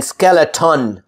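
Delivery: skeleton as skel-e-TAWN; over-full last syllable. Shown as a demonstration. Teacher showing what to avoid, skeleton with full "tawn". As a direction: give skeleton a full O in "ton". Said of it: "I don't say skeleton".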